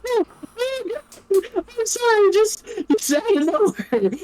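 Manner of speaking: very high, slow squeaky voice; mock squeal. GM repeating the joke in a high mock squeal.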